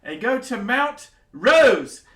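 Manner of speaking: high, loud royal orders; barking eager commands like a giddy monarch; excited king. Acted. King sending the four to the mountains, loud and high.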